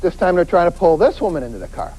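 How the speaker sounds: ordinary talk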